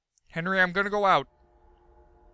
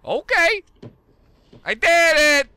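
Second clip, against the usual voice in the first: louder, higher proud cry; triumphant cheer; 11 semitones higher, 6 dB louder than usual. Real, not acted. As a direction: shout the win out loud. Opening the door in game.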